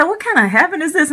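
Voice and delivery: a startled, high cry of confusion; retold astonishment. Dreamer baffled by a run-down heaven, astonished. Shocked inside a strange heaven.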